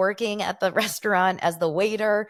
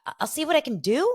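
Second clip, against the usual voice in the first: hesitant waiter; 3 semitones higher than usual. Retold or imitated. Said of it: "And he's like".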